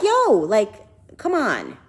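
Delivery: indignant "back off" outburst, retold; exasperated protest. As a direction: push back loudly, telling him to quit. Retold protest at his groping.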